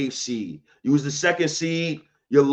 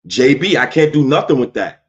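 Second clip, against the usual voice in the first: exasperated outburst; 8 dB louder than usual. Real, not acted. Exasperated at Brunson stats.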